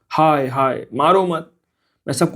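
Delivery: mild nightmare cry, begging and blurting; sleep-talking cry. A narrator's reading. Man dreaming of being beaten, pleading in his sleep. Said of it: "सहसा वह बर्रा उठा".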